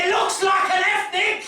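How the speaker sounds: very high mock yell of bewilderment; baffled shouting. Londoners baffled by the comic at a party, high confused shouting.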